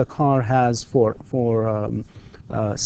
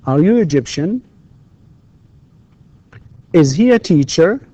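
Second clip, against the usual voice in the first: high, louder drill questions, last word of each rising; rising intonation; 5 semitones higher, 4 dB louder than usual. Shown as a demonstration. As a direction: lift "Egyptian", "teacher", "Cairo", "Riyadh", "children". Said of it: "لاحظ كله rising intonation".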